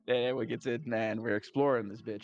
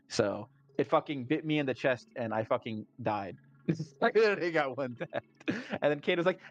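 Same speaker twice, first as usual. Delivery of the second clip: ordinary talk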